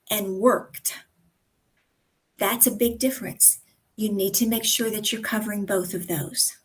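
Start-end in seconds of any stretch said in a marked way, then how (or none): 0.4-0.9 worked with a crisp final t after the k; full ED ending. Shown as a demonstration. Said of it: "E D is often dropped".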